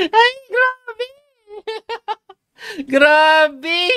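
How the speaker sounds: high laughing "grabe"; real disbelieving laughter; laughing in disbelief. Real, not acted. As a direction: laugh through the words in disbelief. Laughing at the drawn game.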